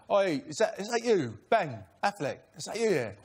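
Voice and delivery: mock British accent, rising on the calls. Actor retelling being hailed in a costume shop, British accent. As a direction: put on a rough British accent for a quote. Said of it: "That's my British accent".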